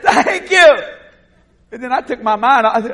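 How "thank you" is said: loud, high-pitched answer; called-back thanks. Retold or imitated. Preacher answering the unknown man once more, calling out. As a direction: holler a polite reply back.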